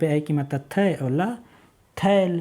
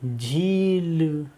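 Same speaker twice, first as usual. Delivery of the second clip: झील stretched very slowly; drawn-out blending; 2 semitones higher, much slower than usual. Shown as a demonstration. Teacher slowly sounding out the blended word. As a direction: draw झील out for the children. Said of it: "तो ये क्या हो गया?".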